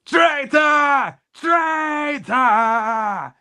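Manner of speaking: high, loud yell of betrayal; furious shout. Acted. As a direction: scream it after them. Character yells after departing rival.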